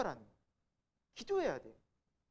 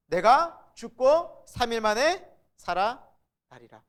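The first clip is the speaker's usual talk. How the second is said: solemn prophecy; 5 semitones higher, 12 dB louder, much slower than usual. Acted. Pastor voicing Jesus's prophecy.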